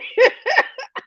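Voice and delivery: a short high giggle; high laughter. Real, not acted. Host giggles helplessly at the viewer's name, short high laugh.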